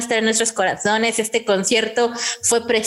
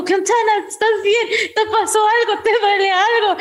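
concerned idol; 10 semitones higher than usual. Retold or imitated. Idol checking on a fan who stumbled, high and worried. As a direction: chirp out caring questions. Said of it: "me agarró de la cabeza y me dijo".

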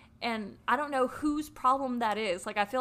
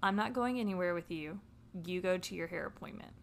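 low-key, softer, holding it in; controlled composure; 3 semitones lower, 6 dB quieter, faster than usual. Retold or imitated. Woman dismissing cheating boyfriend, contained and controlled. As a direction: stay in control, no tears. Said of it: "I love honing in and not crying".

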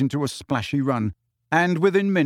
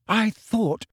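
higher, tearful catch; tearful I thought; 8 semitones higher than usual. A narrator's reading. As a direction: through tears, hurt. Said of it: "tears spattering down onto the table".

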